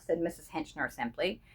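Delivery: plain reading voice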